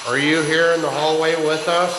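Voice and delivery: loud, high questioning call; calling out to spirits. Real, not acted. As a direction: project it down an empty hallway.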